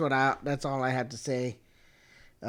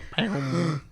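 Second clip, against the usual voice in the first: imitated gasp. Theater goes quiet before Vader.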